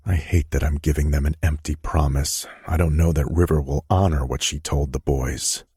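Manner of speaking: plain reading voice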